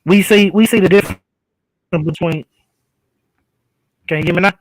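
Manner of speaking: mic popping on every word; crackly usb mic pops. Real, not acted. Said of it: "but now it's popping".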